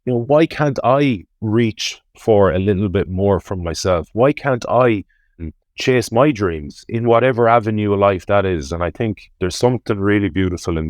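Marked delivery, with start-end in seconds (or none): none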